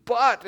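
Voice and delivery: But hit high and loud; stressed but. Shown as a demonstration. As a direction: punch the word But hard as a contrast. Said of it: "in the strongest word that he can use to create a contrast".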